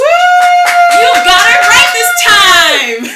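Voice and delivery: loud, high, slow laughing cheer; excited shout. Real, not acted. Host cheering guest's correct response.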